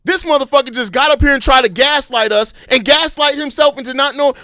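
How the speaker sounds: normal talking voice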